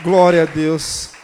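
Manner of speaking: slow, loud proclamation; slow, loud exultant praise. Real, not acted. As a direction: proclaim it to the church. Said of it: "preacher's exclamation".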